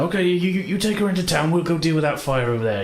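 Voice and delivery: high, hurried farmer's voice; farmer giving orders. Acted. Farmer to the party, high and urgent. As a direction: take charge, send them off to town. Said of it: "He said".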